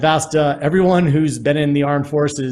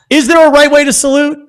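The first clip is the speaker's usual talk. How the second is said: excited question; 10 semitones higher, 8 dB louder than usual. Real, not acted. Host calls question to guests.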